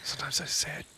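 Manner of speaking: real whisper, barely audible. Real, not acted. Host admitting a fault to his co-host, whispered. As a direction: confide it in a very soft whisper. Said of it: "I'll whisper now".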